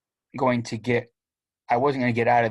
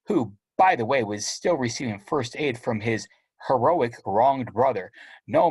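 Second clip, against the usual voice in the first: plain reading voice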